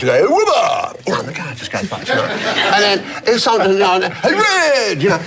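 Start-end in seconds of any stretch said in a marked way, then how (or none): 0.3-1.0 high call from a passing car; mock drive-by shout, pitched up. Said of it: "they'd call out from the car". 4.1-5.0 high-pitched hail from a car; imitated drive-by call. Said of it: "they'd call out from the car".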